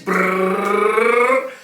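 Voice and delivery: rolled lips drum roll. Pretend. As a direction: buzz out a mouth drum roll. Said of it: "Drum roll, please".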